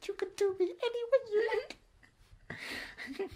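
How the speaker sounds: very high, small Icelandic singer voice; put-on high voice, in character. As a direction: answer in a squeaky celebrity caricature. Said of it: "Yeah, [name], what do you think".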